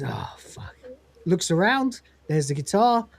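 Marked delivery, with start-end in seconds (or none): 0.0-0.8 very low, voiceless whisper; defeated curse. Retold or imitated. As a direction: let it out under your breath, beaten. Lister's despairing curse, retold.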